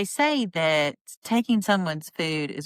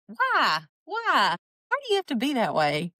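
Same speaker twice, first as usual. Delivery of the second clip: exasperated why; 6 semitones higher than usual. Real, not acted. Exasperated at Mary's comment.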